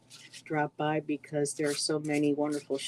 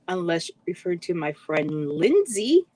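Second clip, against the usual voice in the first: ordinary talk, nothing marked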